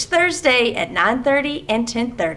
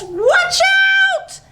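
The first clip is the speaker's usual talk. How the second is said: warning shout; 4 dB louder than usual. Acted. Animals about to crash.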